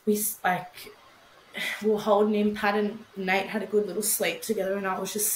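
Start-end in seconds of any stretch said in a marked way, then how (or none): none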